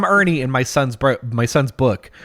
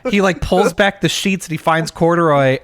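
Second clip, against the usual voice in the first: ordinary delivery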